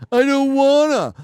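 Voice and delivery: mock a pouting toddler who won't; fussy toddler. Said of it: "like a fussy toddler".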